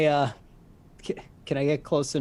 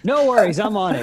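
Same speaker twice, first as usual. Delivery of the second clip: high, loud burst of eager confidence; high-pitched and laughing at the end; gleeful shout; 6 semitones higher, 9 dB louder, faster than usual. Acted. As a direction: call it out brightly as you take off. Player gleefully acts flying off.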